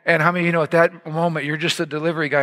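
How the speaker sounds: usual speaking voice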